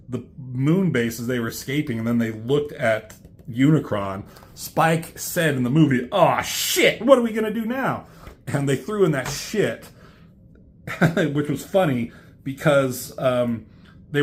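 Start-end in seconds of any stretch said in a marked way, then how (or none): 6.1-8.1 high, hurried acted alarm; hammed-up dismay; mock panic. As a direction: fret in a raised, rushing voice. Acting out Spike's alarmed line.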